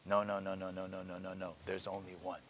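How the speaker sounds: soft, low repeated no; a hushed string of no; quiet rejection. Real, not acted. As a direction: shake it off under your breath. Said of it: "That's absurd".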